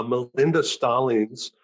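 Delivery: ordinary delivery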